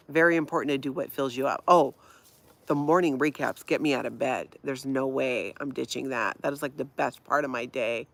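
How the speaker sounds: normal talking voice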